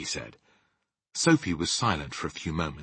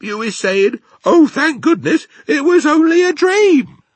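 loud rustic giant climbing ever higher; BFG, scornful; 12 dB louder than usual. Acted. Giant explaining why nobody believes dreams, scornful. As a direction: build to a high, shouted mock relief.

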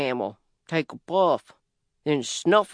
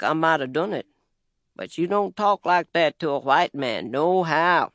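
usual speaking voice

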